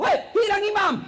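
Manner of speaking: high-pitched mock yell; retold shout. Naughty schoolboy alerting the congregation mid-prayer, mock yell. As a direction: call out in comic alarm. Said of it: "langsung teriak".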